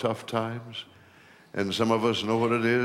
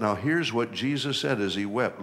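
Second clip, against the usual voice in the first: usual speaking voice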